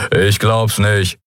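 slightly drawn-out Skria voice; lightly voiced Skria calling out, slowly. A narrator's reading. Skria heard through the floor, calling out. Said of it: "hörte sie einen Skria rufen".

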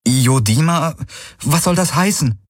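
high, insistent, puzzled echo; baffled questioning. A narrator's reading. Kirai asks what the alien word means, high and puzzled.

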